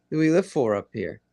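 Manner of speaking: ordinary talk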